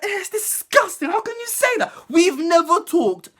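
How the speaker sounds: hammed-up disgusted teacher; mock outraged teacher. PE teacher scolding, high voice.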